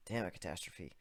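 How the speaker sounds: plain reading voice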